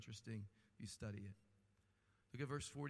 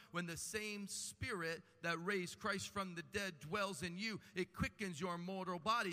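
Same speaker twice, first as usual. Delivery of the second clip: normal talking voice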